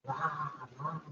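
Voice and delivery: quiet, gentle mock roar; a tame little roar to a frightened mouse; soft lion roar. Shown as a demonstration. Lion greeting the little mouse, soft gentle roar. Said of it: "says the lion".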